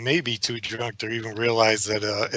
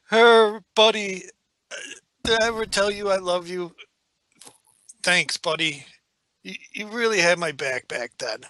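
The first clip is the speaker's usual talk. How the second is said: put-on loud, higher-pitched drunken affection; Grodd, drunk; 7 semitones higher, 3 dB louder than usual. Drunk pilot telling his meditating buddy he loves him, drunkenly. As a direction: gush at a buddy like a sloppy drunk. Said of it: "a very drunk Grodd".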